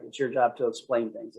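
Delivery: usual speaking voice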